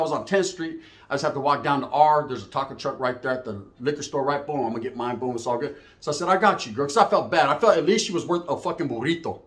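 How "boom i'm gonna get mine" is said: plain reading voice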